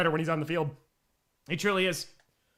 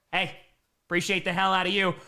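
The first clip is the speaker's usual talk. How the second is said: ordinary talk, nothing marked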